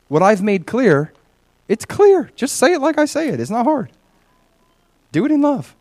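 high-pitched, insistent plea; exasperated urging. Acted. God urges believers to speak truth, exasperated insistence. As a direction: insist on it as if it were obvious. God's urging voiced by preacher.

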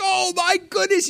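high, awed exclamation; amazed. Pretend. Believer shown another side of God, high amazed exclamation. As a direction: gasp it out in wonder. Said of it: "You're like".

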